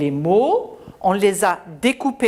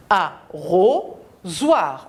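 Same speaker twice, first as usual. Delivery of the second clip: slow "Arrosoir" split into a, ro, soir; drawn-out, separated beats on "Arrosoir"; syllable by syllable; 3 semitones lower, much slower than usual. Shown as a demonstration. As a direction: pause between the three syllables of "Arrosoir". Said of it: "a trois syllabes".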